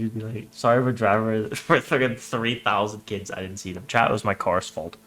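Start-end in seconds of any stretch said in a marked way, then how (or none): none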